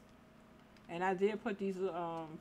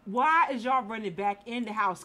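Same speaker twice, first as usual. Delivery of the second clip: louder scolding question; loud exasperation; 8 dB louder than usual. Real, not acted. Player yelling at sims running back inside, loud. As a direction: call them out, exasperated. Sims run into burning house.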